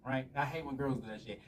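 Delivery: normal talking voice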